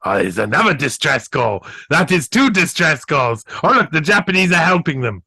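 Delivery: usual speaking voice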